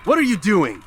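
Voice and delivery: alarmed as the blade comes at him; alarmed cry. Acted. Altair alarmed as master attacks.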